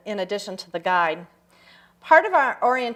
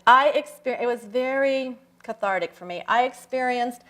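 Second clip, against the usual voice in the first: ordinary talk, nothing marked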